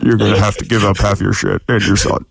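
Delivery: cold rasping evil emperor voice; Dark Lord. Pretend. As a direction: menace him like a villain. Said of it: "Sounds like the Dark Lord".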